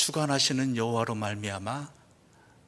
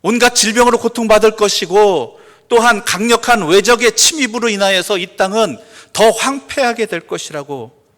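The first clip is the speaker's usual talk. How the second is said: loud, high scripture declaration; full-force preaching; 8 semitones higher, 15 dB louder than usual. Real, not acted. Preacher reciting the barren fig tree verse, full-force preaching. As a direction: proclaim the verse across the hall.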